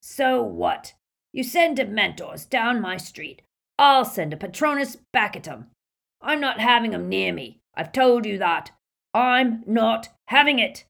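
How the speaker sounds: angry, high, loud barman's rant; loud, defiant outburst; bellowing. A narrator's reading. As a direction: bellow it at them, refusing to back down. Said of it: "he was bellowing into one of".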